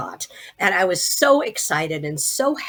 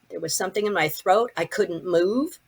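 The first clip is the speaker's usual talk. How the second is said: usual speaking voice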